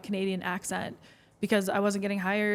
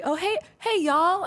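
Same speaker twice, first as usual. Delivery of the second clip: put-on Nashville girlfriends' greeting; 9 semitones higher, 3 dB louder than usual. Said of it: "they're always like".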